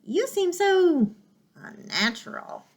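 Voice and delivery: high then slowly fading owl. Acted. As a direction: draw it out with distaste. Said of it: "screeched the owl".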